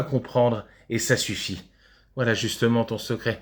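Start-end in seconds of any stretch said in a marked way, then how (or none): none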